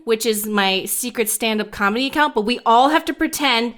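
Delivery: ordinary talk, nothing marked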